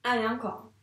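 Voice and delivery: ordinary delivery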